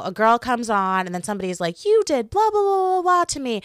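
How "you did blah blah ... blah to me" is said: high, loud mocking whine; sing-song mockery of an accuser. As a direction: parody the accusing girl, way up high.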